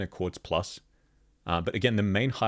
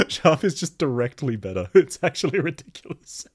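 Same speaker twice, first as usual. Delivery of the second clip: laughing; 6 semitones higher, 5 dB louder than usual. Real, not acted. Laughing while ranking Franca.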